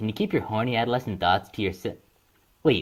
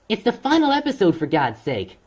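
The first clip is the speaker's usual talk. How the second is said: exasperated; 9 semitones higher, 3 dB louder than usual. Acted. Exasperated outburst.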